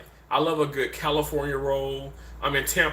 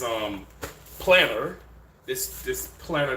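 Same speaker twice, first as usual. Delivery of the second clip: ordinary delivery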